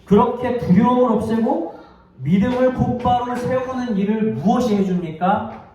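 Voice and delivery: high, insistent sermon delivery; impassioned preaching. Real, not acted. Preacher urging the congregation to rise after hurt, impassioned. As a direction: drive each phrase home with fervor. Preacher builds to the main point.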